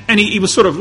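normal talking voice

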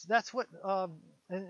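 normal talking voice

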